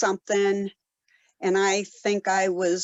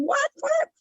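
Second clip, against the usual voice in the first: mock scream. Runner retelling screams in a thunderstorm, mock scream. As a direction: re-enact a scream at a high pitch. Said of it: "trying to scream".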